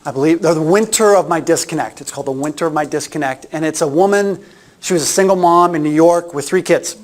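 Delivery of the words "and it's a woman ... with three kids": ordinary delivery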